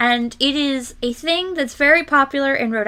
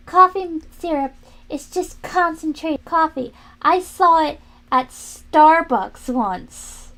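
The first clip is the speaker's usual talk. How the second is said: higher, louder, smug, slow at the end; mock know-it-all; 5 semitones higher, 5 dB louder, slower than usual. Imitating the arguing guy insisting it's concentrated coffee. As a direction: mimic the overconfident guy. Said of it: "he was like".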